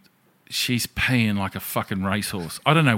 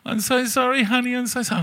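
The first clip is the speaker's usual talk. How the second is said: much higher, sing-song sorry voice; drunk apology; 3 dB louder than usual. Retold or imitated. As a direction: simper it like a drunk girlfriend. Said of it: "I'm like".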